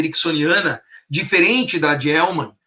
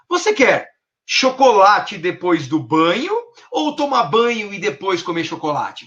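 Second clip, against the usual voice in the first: slow "você quer", BANHO swung upward before the alternative; questioning tone; 2 semitones higher, slower than usual. Shown as a demonstration. Parent gives child a choice, questioning. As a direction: pose two options, lifting the first and lowering the last.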